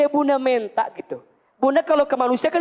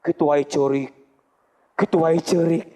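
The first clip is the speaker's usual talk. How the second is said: lower, sneering mockery; scoffing at a crybaby; 7 semitones lower than usual. A scoffer mocking a crying mother, lower and sneering. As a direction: jeer at someone crying.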